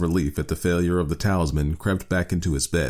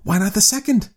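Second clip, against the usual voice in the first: high-pitched eager challenge; feverish; 4 dB louder than usual. A narrator's reading. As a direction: press the argument feverishly. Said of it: "said the old woman feverishly".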